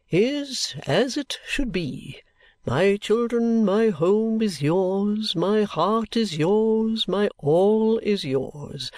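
slow, grandiose, swelling oratory; theatrical patriarch bestowing his sacrifice; pompous old father. A narrator's reading. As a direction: draw out every word with self-important solemnity.

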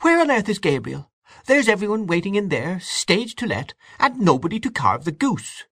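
high, exasperated complaint held all through; despairing cry. A narrator's reading. Aunt Kate with nobody to carve, high despairing cry. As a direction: complain in flustered despair, pitched well up. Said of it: "she cried".

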